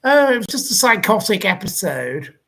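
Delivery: sneering send-up of a flimsy excuse. Retold or imitated. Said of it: "And he went".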